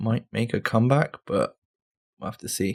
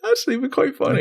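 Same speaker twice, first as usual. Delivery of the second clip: mock inner voice; much faster than usual. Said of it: "You're like".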